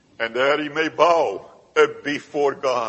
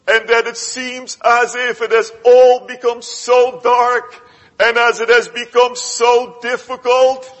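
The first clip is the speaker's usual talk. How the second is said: raised, louder pleading sermon climax; impassioned preaching; 8 semitones higher, 5 dB louder than usual. Acted. Preacher urging the congregation to feel the darkness, raised and pleading. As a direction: press the congregation, voice lifted high.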